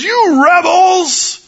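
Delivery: high, slow, roaring yell; furious shout. Retold or imitated. Moses yelling at the thirsty people, furious shout. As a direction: blast it at them. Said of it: "it says".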